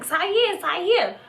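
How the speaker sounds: high-pitched mock yell; imitated yelling. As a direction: call his name high, imitating her. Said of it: "Amber is yelling still".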